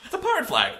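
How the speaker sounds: very high laughing put-on voice; mock high silly voice. Joking reply about the pirate flag, squeaky and laughing. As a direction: answer in a giggly squeaky voice.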